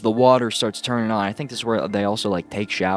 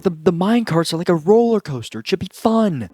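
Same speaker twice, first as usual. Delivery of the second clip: mock giddy teens; 7 semitones higher than usual. Teens voiced gleefully.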